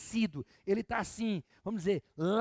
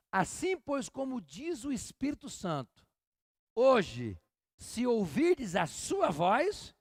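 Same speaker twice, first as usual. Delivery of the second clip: slow, raised reading voice; scripture reading; 3 semitones higher, 3 dB louder, much slower than usual. Acted. Pastor reads Hebrews to the congregation, slow solemn reading. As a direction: read the verse out with weight. Reads the Bible verse aloud.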